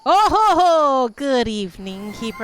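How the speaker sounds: slow hammy villain greeting; sinister laugh. Acted. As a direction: gloat like a ghost.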